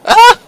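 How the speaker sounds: very high, loud squeal; laughing scream. Real, not acted. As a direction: shriek as it goes down. Plane crashing.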